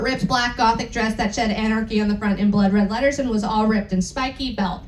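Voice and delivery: ordinary talk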